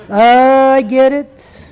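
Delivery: loud, drawn-out dawning realisation; mock realisation. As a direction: stretch it out slowly as the penny drops. Mock realisation about the boastful pilot.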